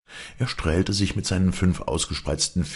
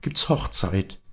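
joyful excitement; 9 semitones higher than usual. A narrator's reading. Maren rejoicing about rain and wedding.

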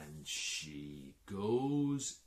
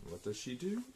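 plain reading voice

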